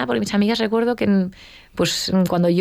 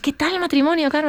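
much higher, sing-song question; mock chirpy colleague; 9 semitones higher than usual. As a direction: imitate the nosy coworkers. Imita a las compañeras preguntando. (compañeras curiosas, voz aguda y cantarina)

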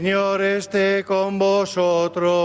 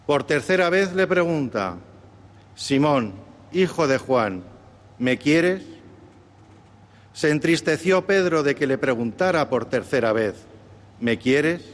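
solemn Gospel reading; 4 semitones lower than usual. Acted. Deacon proclaims the Gospel reading.

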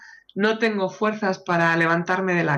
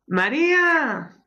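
calling out; 6 semitones higher, much slower than usual. Acted. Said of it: "llámala desde ahí".

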